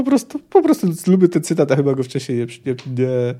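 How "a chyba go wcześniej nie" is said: ordinary delivery, nothing marked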